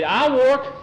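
put-on high, loud boyish outburst; eager volunteering. A narrator's reading. Boy offers to work for his passage, eager.

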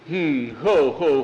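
hammed-up pompous "ho, ho"; mock chuckle. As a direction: chuckle like a self-important prince.

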